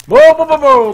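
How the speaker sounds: high, loud run of whoas; rapid-fire high yelps of alarm; startled alarm. Real, not acted. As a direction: cry out to halt it. Startled in game.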